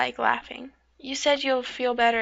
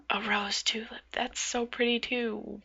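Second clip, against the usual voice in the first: soft whispered admiration; 7 dB quieter than usual. Acted. Admiring a pretty seashell, soft whisper. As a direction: murmur it under your breath.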